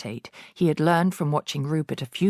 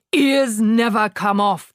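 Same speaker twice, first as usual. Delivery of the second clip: louder slow dismissive shout; 5 semitones higher, 6 dB louder, slower than usual. A narrator's reading. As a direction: shout her down. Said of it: "the Trunchbull shouted".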